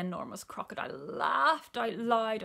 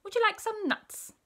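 high, loud, friendly offer; chirpy monkey; 13 semitones higher, 5 dB louder than usual. A narrator's reading. Monkey offers crocodile some nuts, high and chirpy. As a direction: pipe it up brightly.

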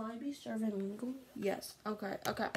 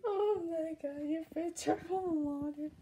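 shocked cry; 5 semitones higher than usual. Real, not acted. Crying out in shock.

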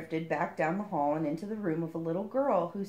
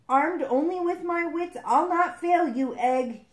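higher, slow, loud heroic vow; brave vow; 10 semitones higher, 7 dB louder than usual. A narrator's reading. Frog vowing to rescue his egg, hammed-up heroic vow. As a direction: proclaim it like a storybook hero. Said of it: "he vowed bravely".

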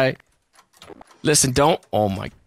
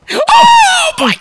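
high loud shriek; 7 dB louder than usual. Real, not acted. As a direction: shriek in panic. Panic in dark.

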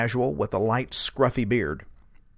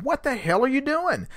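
high-pitched indignant challenge; retold exasperation; 12 semitones higher than usual. Driver imagining confronting the tailgating sheriff, high mock indignation. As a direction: imitate an outraged question, pitched way up.

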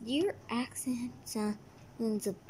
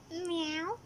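cat meow; 3 semitones higher, 5 dB quieter than usual. Acted.

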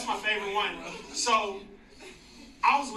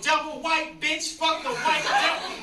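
higher, drawn-out mock preacher rant; ranting street preacher; 7 semitones higher, 5 dB louder than usual. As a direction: imitate a preacher going off. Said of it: "one main dude that's the preacher".

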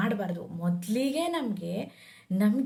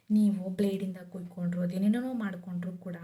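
normal talking voice

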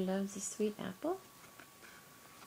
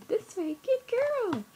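normal talking voice

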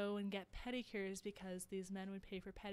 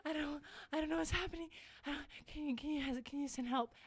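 a hint of tearful panic, slightly higher; lightly imitated panic; mock panicked sobbing; 6 semitones higher than usual. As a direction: retell a frantic plea half-heartedly. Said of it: "I'm, like, sobbing".

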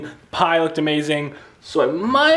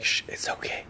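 whispered shush and soothing; imitating daughter's shush; 13 dB quieter than usual. Dad repeating how his daughter comforted him, whispered. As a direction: hush someone gently like a small child. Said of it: "she's like".

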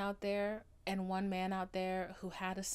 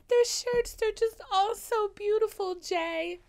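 much higher and louder, swooning over the shirts; Daisy gushing; 13 semitones higher, 9 dB louder than usual. Retold or imitated. Daisy weeping over Jay's shirts, high and swooning. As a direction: gasp out the praise like she's overwhelmed. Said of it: "she's like".

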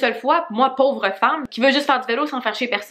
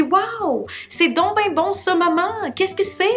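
plus aiguë, lente, émerveillée; voix d'enfant ravie; 8 semitones higher, much slower than usual. Retold or imitated. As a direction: joue la petite qui adore ça. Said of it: "J'ai dit". (imitation de la fillette ravie, plus aiguë)

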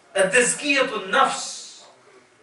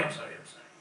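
breathless whispered sorry, barely voiced; whispered apology; 22 dB quieter, much faster than usual. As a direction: whisper the apology hastily under your breath. Musa apologising to Khidr.

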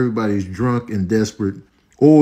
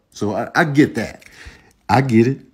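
normal talking voice

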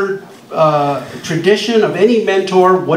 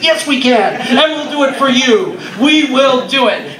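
much higher, eager and insistent, the last line slow; excited promise; 8 semitones higher than usual. Retold or imitated. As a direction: swear it to them with a rush of joy. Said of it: "I said".

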